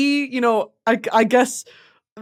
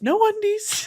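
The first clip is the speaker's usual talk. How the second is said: ordinary talk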